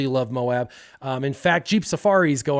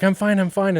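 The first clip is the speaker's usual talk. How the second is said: higher-pitched hasty reassurance; faking being fine; 6 semitones higher, 3 dB louder than usual. Acted. Crashed kid lies to the boys, pitched-up reassurance. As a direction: cover the hurt with pitched-up insistence. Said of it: "I'm lying to them".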